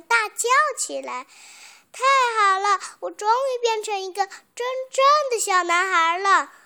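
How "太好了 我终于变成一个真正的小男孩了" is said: high, loud, jubilant cry; happy shout. A narrator's reading. Boy hugs the old carpenter on becoming real, shouting happily. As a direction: cry out with delight. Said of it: "高兴地抱住老木匠， 大叫起来".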